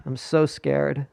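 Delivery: slow, drawn-out mock fear; mock scared. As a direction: whine it like a frightened husband. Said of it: "So scared to have sex".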